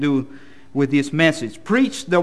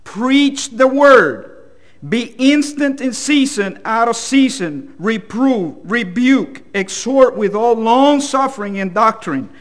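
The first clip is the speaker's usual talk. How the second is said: raised, forceful pulpit reading; emphatic scripture reading; 5 semitones higher, 5 dB louder than usual. Acted. Reads scripture aloud to congregation.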